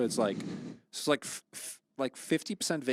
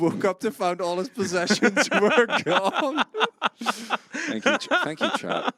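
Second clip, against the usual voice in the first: high laughing voice; 10 semitones higher, 8 dB louder, slower than usual. Real, not acted. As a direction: laugh through the words. Laughing through thanks.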